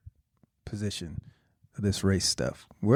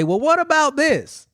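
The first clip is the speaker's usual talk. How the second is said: mocking question; 9 semitones higher than usual. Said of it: "and you say".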